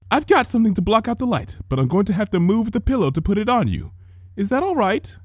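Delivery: a hint of a fussy older sister; gentle big sister tending a sick sibling; Rarity's voice. A narrator's reading.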